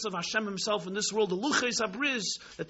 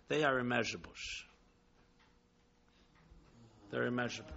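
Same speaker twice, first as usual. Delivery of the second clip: hushed; 9 semitones lower, 10 dB quieter, much faster than usual. Acted. Quiet answer from the people.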